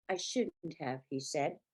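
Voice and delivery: soft, low regret; devastated brother admitting fault, soft and low; deflated. A narrator's reading. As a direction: concede it quietly, beaten.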